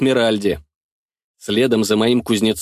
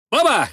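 gunshot imitation; 11 semitones higher than usual. A narrator's reading.

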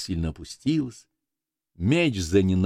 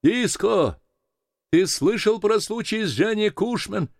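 high-pitched call through fog. A narrator's reading. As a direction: hail another boat in a raised, high voice. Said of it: "чей-то голос закричал в тумане". (высоким голосом окликая издали)

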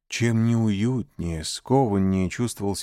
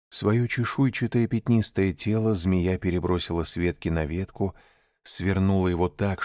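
ordinary delivery

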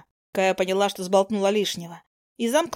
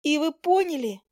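higher little squeak; 6 semitones higher than usual. A narrator's reading. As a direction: squeak it out timidly. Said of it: "пискнула она". (Кая пискнула, выше и робко)

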